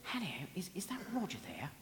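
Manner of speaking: quiet, put-on polite caller voice; softly posing as a secretary on the phone; prank-call voice. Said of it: "I used to ring up and say".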